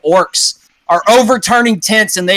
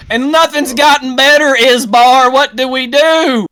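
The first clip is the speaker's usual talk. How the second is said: frustrated follower; 10 semitones higher, 4 dB louder than usual. Acted. Follower challenging leader.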